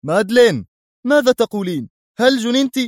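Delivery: high, loud outraged shout; jealous young man; angry outcry. Acted. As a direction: yell at her in disbelief. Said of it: "صاح بها الشاب في غضب واستنكار".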